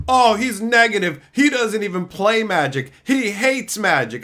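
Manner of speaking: a hint of a whiny Redditor; mocking Redditor. Mimicking Redditors dismissing him, light mock whine. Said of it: "they're like".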